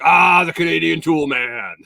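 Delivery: playful shout of a nickname; hearty clerk greeting. Retold or imitated. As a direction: boom it out like a chummy clerk.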